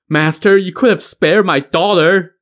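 high, pleading narrated wail; wailed reproach. A narrator's reading. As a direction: lament to the man who killed your child. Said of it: "the old squire wailed".

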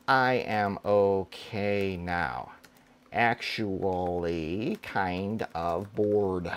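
slow, soft, deflated bored read; mock boredom. Shown as a demonstration. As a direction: let it sag like you can't be bothered. Reading bored test sentence.

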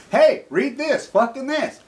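high, loud, dismissive mimicry of a pushy sender; mocking imitation. Speaker imitating people who send him cancer cures, mocking. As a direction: sneer as you parrot them.

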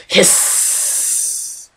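high unvoiced hiss like steam; a vanishing monster's hiss; hissing sound. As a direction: let out a long breathy hiss. Monster vaporizing, sound effect.

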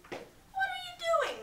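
mock the wolf from above. A narrator's reading. Kip mocking the listening wolf, high and slow. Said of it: "mocked Kip".

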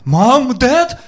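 high frightened call into the dark; scared child calling. Retold or imitated. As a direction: call for mom and dad like a scared kid. Woken child calling out in fear.

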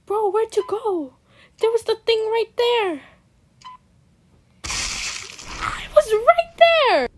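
high, loud protest, building to a drawn-out yell; exasperated yelling. Real, not acted. Game frustration, exclaiming loudly.

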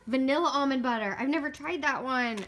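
ordinary talk, nothing marked

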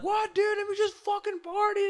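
put-on high whiny drunk plea; drunk whine. Drunk begging the bouncer to let him party, high whine. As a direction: beg like a drunk.

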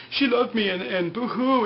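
high, whiny fake blubbering; mock weeping. Mimicking Locke grieving for Helen, mock tearful.

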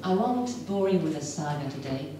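usual speaking voice

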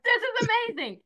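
high, loud amazed cry; thrilled exclamation. Acted. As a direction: burst out with delighted excitement. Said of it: "And I was like".

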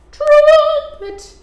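high and loud blast; trumpeting. Acted. Elephant recap.

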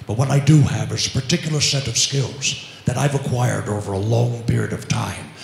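low, fast threatening growl; Liam Neeson impression. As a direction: menace him low and quick. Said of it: "Jason Statham".

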